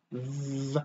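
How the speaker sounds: "V" as a long buzz, lip to teeth. Shown as a demonstration. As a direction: buzz the V softly. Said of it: "bottom lip touches your teeth".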